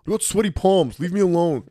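high, loud mock protest; hammed-up exasperated retort; defensive outburst. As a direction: push it up high, comically defensive. Acted defensive retort in relationship bit.

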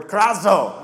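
mock bird-like yell of KRAZO; imitated yell. Preacher imitating the crane that woke him, imitated yell. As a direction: call out KRAZO like a startling cry. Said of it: "it almost was like yelling out".

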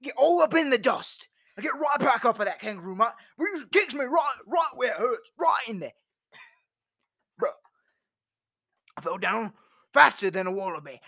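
hammed-up Australian tale; Australian accent. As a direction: spin the yarn like an Aussie bloke. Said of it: "changed to Australian".

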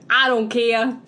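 high, loud, hammed-up New York Italian storyteller; New York Italian accent. Shown as a demonstration. Rushing the nativity to its punchline, New York Italian accent. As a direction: rattle through the tale as a cartoon New York Italian. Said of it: "New York Italian accent".

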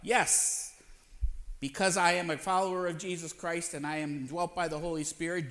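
usual speaking voice

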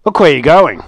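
loud growling telling-off; angry wolf. A narrator's reading. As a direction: snap at her like an angry wolf. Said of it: "said Mister Wolf angrily".

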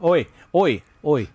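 put-on Australian accent. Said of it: "No, that's British".

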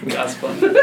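high, airy words through a laugh; laughing echo. Real, not acted. Echoing the co-hosts' phrase, laughing.

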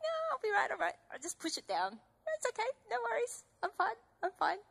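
high, breezy dismissal; brushing it off. Retold or imitated. Brushing off a warning, high and breezy. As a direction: wave the warning away lightly. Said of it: "I'm like".

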